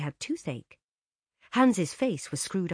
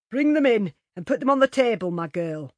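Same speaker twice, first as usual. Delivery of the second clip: louder cook giving an order; 6 semitones higher, 9 dB louder than usual. A narrator's reading. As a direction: command the maid. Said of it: "ordered cook".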